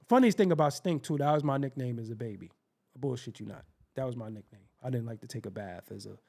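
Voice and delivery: normal talking voice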